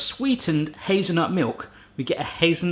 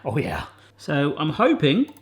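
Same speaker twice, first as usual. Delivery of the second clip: ordinary talk, nothing marked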